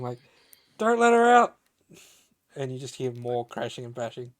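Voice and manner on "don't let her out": loud, high urgent yell; guard yelling. Acted. Guard calling down the corridor about an escape, yelling. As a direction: yell the order like an alarmed guard. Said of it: "like, yelling".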